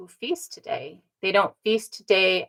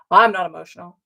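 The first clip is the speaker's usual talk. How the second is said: louder, insistent mock denial; retold protest; 6 dB louder, much faster than usual. Speaker denying the relative's claim, retold protest. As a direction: push back firmly, acting out the exchange. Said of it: "I'm like".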